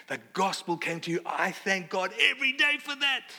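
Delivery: acted-out gushing, voice climbing high; Paul's fervent thanks. Paul thanks the church for receiving the gospel, fervently. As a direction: build to a high, emphatic finish as Paul. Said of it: "He's saying".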